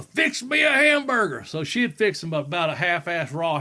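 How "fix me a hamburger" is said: higher, louder barked order; gruff demand. Retold or imitated. Grandfather ordering his wife to cook, loud. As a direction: demand it like a drunk old man. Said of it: "He'd go".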